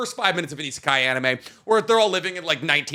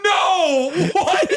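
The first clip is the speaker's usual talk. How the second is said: high shocked shout; overacted anime dub; 12 semitones higher, 4 dB louder than usual.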